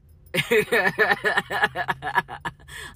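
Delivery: long, loud run of laughter; loud cackling laugh. Real, not acted. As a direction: break into a big cackle mid-rant. Laughs amid angry venting.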